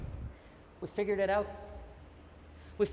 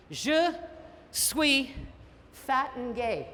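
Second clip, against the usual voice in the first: mock French; 3 semitones higher, slower than usual. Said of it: "learned how to say something in French".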